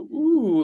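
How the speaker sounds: high, drawn-out admiring ooh; an awed ooh at a great throw; impressed ooh. Retold or imitated. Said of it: "the ones that make you go".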